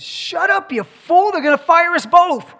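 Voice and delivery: high, loud scolding punchline; lion's angry hiss-shout. Retold or imitated. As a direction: snap at him to shut up. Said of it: "the lion gets right up next to the guy and says".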